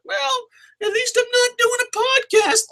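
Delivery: very high, silly falsetto; ridiculing falsetto parody; mock wrestler's jab. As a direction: mimic a petty put-down in a squeaky voice. Mocking wrestler's jab at podcasters.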